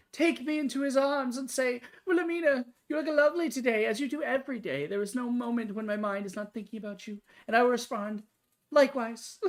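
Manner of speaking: lofty ladylike character voice; prim high-society lady. Acted. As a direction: play a vain, swooning spinster. Voicing Wilhelmina fantasy.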